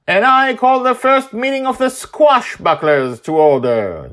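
high loud mock proclamation; copying his tone. Daughter mimicking her father's call to order, loud and high. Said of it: "Joy copies his tone".

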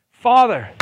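high, loud call of Father; loud, pleading Father; fervent cry. Acted. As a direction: call out like a child to its dad. Voicing the spirit crying Father.

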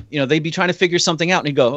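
ordinary delivery

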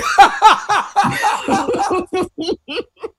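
high loud burst of laughter. Real, not acted. Big laugh after joke.

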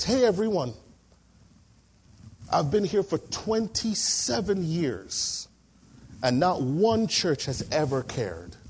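slow, moved, calling the room to attention; a police inspector, moved, tells the whole room, slow and emotional; moved announcement. Retold or imitated.

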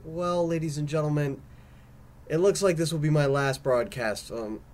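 ordinary talk, nothing marked

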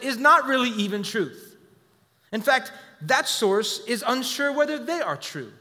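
urgent sermon climax, loud and high; fiery preaching. Acted. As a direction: press the warning hard from the pulpit. Preacher at full force.

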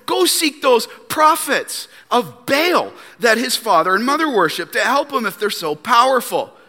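high-pitched mock challenge; sarcastic. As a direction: dare him with mocking advice. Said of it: "he sarcastically tells Jehoram".

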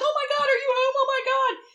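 high, loud panicked gasping; a mother suddenly terrified for her son; imitating a frantic mom. Mom panics her son's home.

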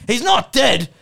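high, indignant yell; Duck. Acted. Duck objects to Eddie being spoken of as gone, yelling. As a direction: explode in protest. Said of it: "aggressive Australian male".

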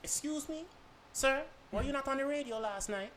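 high put-on voice of a curious shopper; imitated store customer. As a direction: mimic a customer timidly approaching with a question. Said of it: "you're gonna be like".

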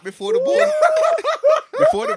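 loud, high, cracking up; squealing with laughter while talking; laughing. Real, not acted. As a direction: talk through helpless laughter. Cracking up at own prank voice.

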